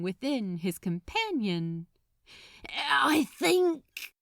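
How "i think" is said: higher, louder, pushing through nerves; blurting it out. A narrator's reading. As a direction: force the words out at last. Crowley forcing out confession.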